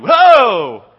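loud, high startled whoa; sudden frightened Whoa; startled cry. Acted. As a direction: jump back in shock. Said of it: "That's why it says, they were startled".

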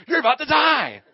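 high, loud doom warning; mom's warning. Retold or imitated. Mom's voice as he sinks, loud warning. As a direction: boom the warning like a haunting voice. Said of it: "hear my mom's voice".

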